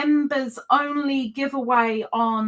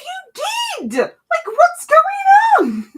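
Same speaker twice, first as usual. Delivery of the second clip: high, loud, delighted disbelief; excited shout; 8 dB louder, slower than usual. Real, not acted. Streamer cheering a viewer's first live, excited shout. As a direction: shout it out, thrilled she finally came. Surprised greeting to a livestream viewer.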